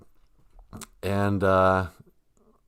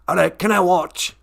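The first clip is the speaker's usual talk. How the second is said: higher put-on spirit voice; Great Spirit character; 7 semitones higher than usual. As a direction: ask to watch in an odd accent. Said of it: "accent is from".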